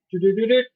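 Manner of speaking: high sung beam noise do do do; mock beam sound. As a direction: hum the zap of a ray gun. Imitating beam firing sound.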